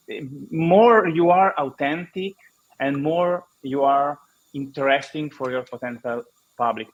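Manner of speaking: ordinary talk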